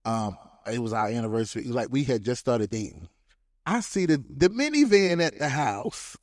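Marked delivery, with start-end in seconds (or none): none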